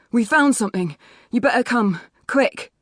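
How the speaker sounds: high, loud, winded urgency; urgent, tumbling rush. A narrator's reading. Young builder summoning the homeowner to a find, urgent and breathless. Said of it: "his words tumble out in a rush".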